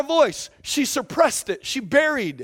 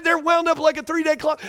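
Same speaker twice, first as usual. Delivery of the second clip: usual speaking voice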